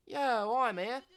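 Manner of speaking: a loud cheeky Aussie skater hyping a mate; Australian skater. Acted. Voices skater Zoe.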